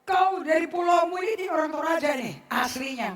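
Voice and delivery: ordinary delivery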